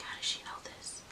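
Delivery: whispered inner thought, breath only; stunned whisper. Retold or imitated. Shaken by her mother's words, thinking it in a whisper. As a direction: whisper it like a private shock.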